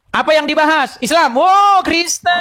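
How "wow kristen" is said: mock surprise. Mock amazement at topic.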